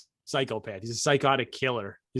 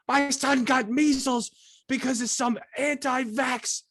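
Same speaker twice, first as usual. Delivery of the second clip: high, slow, outraged mother; mock the angry TV mom, pitched high; slow high-voiced indignant mother; imitating the mother; 9 semitones higher, slower than usual. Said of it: "this is what the mother says".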